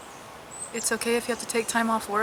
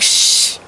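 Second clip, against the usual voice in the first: loud shush; 9 dB louder than usual. Retold or imitated. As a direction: cut the chatter with a hard hiss. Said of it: "if two people are talking".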